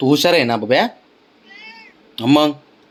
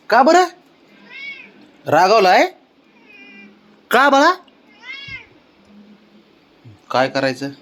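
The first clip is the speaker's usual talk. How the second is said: high, coaxing sing-song to a sulking cat; pet baby talk; 12 semitones higher than usual. Acted. Owner coaxing his sulking cat, high baby talk. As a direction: sweet-talk the pet out of its sulk, pitched high.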